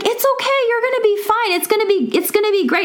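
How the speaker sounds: high, sweet soothing voice quoting the hygienist; a hygienist calming a shaking patient, sweetly high. Retold or imitated. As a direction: coo reassurance up high like a kind hygienist. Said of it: "And she's like".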